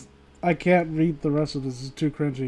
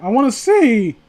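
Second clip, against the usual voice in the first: loud whine; 5 semitones higher, 5 dB louder than usual. Pretend. Mock whiny demand.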